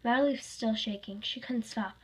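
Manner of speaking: usual speaking voice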